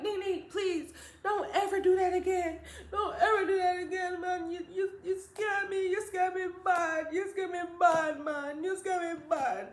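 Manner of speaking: high, crying mother voice; tearful pleading. Retold or imitated. Mother scared by missing child, tearful pleading.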